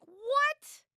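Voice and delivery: high, loud rising squeal of disbelief; shocked disbelief. Retold or imitated. Volunteer retelling her shock at dinner prices, high incredulous cry. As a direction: shoot way up in mock shock.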